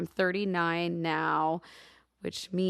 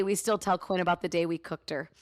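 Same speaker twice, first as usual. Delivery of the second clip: usual speaking voice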